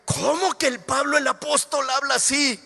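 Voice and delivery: a hint of a scandalized critic; shocked objector. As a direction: play the outraged listener a little high. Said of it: "Alguien diría".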